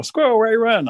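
playful call to a runner; mock calling out. Storyteller improvises for his dinner friend, mock calling out.

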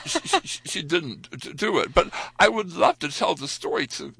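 ordinary talk, nothing marked

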